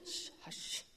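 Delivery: breathy whispered shush from the angel; whispered hush. Retold or imitated. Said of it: "It's going".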